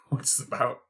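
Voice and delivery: soft unvoiced whisper. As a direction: whisper it conspiratorially. Crow whispers.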